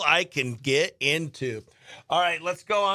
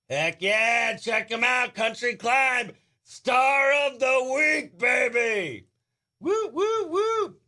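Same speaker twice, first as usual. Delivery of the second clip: higher, building to high woos; hyped cheer; 6 semitones higher, slower than usual. Real, not acted. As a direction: cheer him on like a hype man. Hyping the star of the week.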